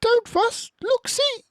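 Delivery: very high squeaky mouse voice. Acted. Mouse comforts the upset bear, squeaky. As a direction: squeak it out tiny and bright. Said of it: "Mouse squeaks".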